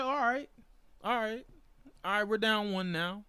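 high-pitched, uneasy self-reassurance; mild retold panic. Fan down one run in the ninth, mildly panicking. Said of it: "I was a little panicking".

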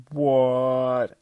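loud, high, drawn-out exclamation; acted stunned outburst; shocked disbelief. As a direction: stretch the word out in loud astonishment. Acted shock at mother's introduction.

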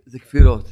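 sternly, זה כפירות; low punched refrain. Acted. Preacher rebuking doubts, low stern verdict. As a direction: drop low and hit זה כפירות hard.

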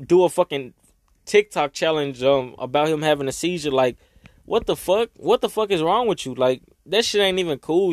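ordinary talk

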